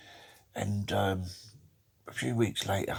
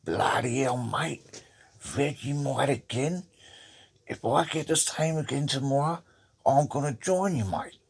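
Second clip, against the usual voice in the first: higher-pitched, hammed-up Australian accent; 7 semitones higher than usual. Australian worker moaning about his sandwiches, put-on Australian accent. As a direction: play a fed-up Aussie bloke with the accent. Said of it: "the Australian fella opens his sandwiches and he goes".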